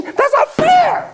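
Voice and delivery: high, slow indignant whine; whiny outrage. Acted. Founders complaining about the crown, high slow whine. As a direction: whine it like a wronged child.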